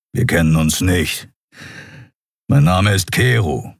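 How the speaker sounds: lightly voiced grumbling beast-man; a mild growl, stating it plainly. A narrator's reading. Big cat-man introduces himself to prisoner, lightly growling. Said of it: "brummte der weiße Skria".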